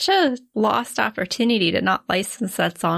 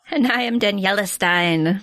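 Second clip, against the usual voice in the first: put-on German accent. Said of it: "give us a good German accent".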